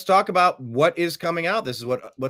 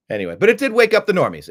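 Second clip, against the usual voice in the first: ordinary delivery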